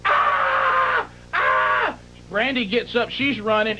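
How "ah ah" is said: high-pitched imitated screams; two drawn-out mock screams, far above usual pitch. Imitating Sherry screaming in panic, mock scream. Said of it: "Sherry is screaming".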